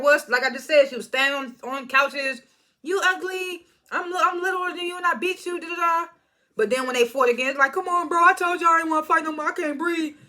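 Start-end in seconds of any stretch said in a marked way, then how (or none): 2.8-6.1 high, mocking taunt. Anna taunting, retold again. 7.7-10.2 high, whiny complaint. Retold or imitated. Anna whining she can't fight.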